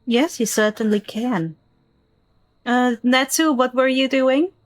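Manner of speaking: ordinary talk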